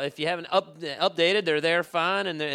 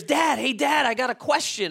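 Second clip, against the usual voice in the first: mock yell of a five-year-old calling downstairs, higher; child calling out; 8 semitones higher, 3 dB louder than usual. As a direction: call up to Dad from bed, pitched up. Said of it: "he yells downstairs".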